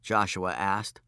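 ordinary delivery

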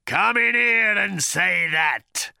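high, slow, apoplectic howl; howling rage. A narrator's reading. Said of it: "howled the voice in a sudden apoplexy".

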